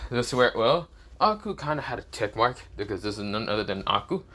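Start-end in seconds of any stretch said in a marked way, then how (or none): none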